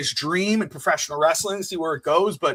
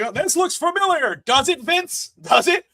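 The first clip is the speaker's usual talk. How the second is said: much higher, hammed-up viewer's reaction; mock rhetorical question; 11 semitones higher, 4 dB louder, slower than usual. Viewer pointedly asking Vince about familiar footage, high mock questioning. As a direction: put on a high, needling question. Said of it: "and you're like".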